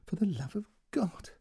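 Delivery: put-on exasperated old man whispering. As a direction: gasp then a whispered for the love of God.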